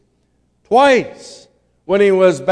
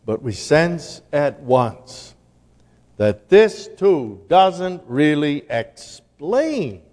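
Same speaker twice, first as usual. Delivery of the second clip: ordinary talk, nothing marked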